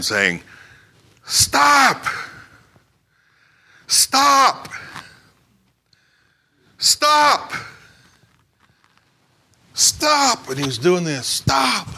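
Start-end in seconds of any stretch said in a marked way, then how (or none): 1.2-10.5 high, drawn-out cry of warning; imitated high-pitched holler; retold screaming stop. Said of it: "kept screaming". 11.3-11.9 high, drawn-out warning cry; imitated high holler; retold screaming stop. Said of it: "kept screaming".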